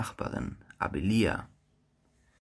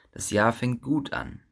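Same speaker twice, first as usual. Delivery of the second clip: ordinary delivery, nothing marked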